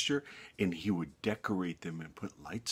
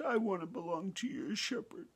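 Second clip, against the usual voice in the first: pleading lamb; 12 semitones higher, 5 dB quieter than usual. A narrator's reading. Brownie pleads to belong.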